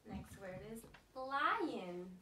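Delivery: put-on drawn out slowly for the child; slow model. Shown as a demonstration. Therapist slowly models the next word. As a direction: stretch "lion" out slowly and clearly. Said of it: "I'm gonna say a word".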